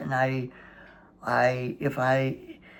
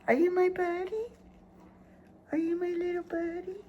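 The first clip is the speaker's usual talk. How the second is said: very high sing-song pet voice; talking to a dog. Real, not acted.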